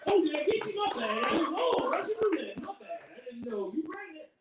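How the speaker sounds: high mock-innocent voice; careless guy. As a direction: play dumb like a careless shooter. Voicing careless shooter.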